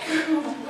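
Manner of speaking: high unhinged little laugh; a giggle that slips out; sudden high cackle of a madman. Acted. Madman narrator laughs to himself.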